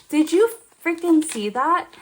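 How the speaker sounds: high, loud outraged question to a friend; heated disbelief. Retold or imitated. Telling Rachel what she just saw, loud and outraged. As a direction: blurt it out, scandalized and fired up.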